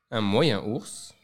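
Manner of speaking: ordinary delivery, nothing marked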